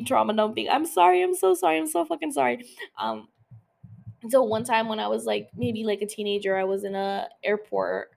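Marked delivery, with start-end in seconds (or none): 0.7-2.7 high-pitched, effusive apology; laughing apology. Real, not acted. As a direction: apologize repeatedly in a raised, giggly pitch. Apologizing after laughing at own oversharing.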